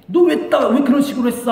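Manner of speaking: high, loud acted reproach; quick outburst of acted rebuke; mock scolding. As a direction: scold as if demanding an explanation. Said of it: "하면서 막 꾸짖고".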